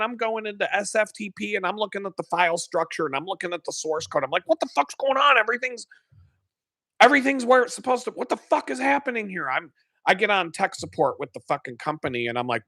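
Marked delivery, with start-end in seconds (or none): 4.5-9.5 high, fast, baffled rant; frantic confusion. Retold or imitated. A developer searching the source code for the fault, high frantic confusion. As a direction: rattle it off like you're panicking. Frantically checking the file structure.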